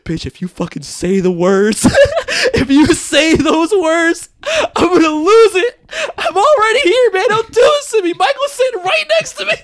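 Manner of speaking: high pitched tearful laughing; laughing and crying. Retold or imitated. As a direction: crack up while welling up. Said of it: "And I'm like".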